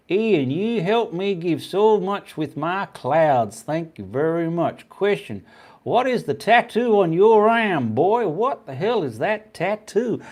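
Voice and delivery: put-on slow, high-pitched, terrible Kentucky accent; overdone Kentucky accent with swooping pitch; bad Kentucky accent. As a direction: read it out in a hammed-up Kentucky accent. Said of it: "That was the worst accent".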